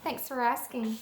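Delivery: put-on storybook read-aloud for little kids; reading to children. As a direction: read it like story time for a class. Teacher reads picture book aloud to kids.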